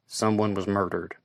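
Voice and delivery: plain reading voice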